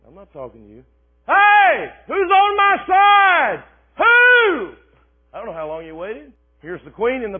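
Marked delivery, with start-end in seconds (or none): 0.1-0.8 muttered dismissal. Acted. Jehu ignoring Jezebel. 1.3-4.7 high, loud, slow call up to the window; Jehu rallying helpers at the window, calling out. Acted.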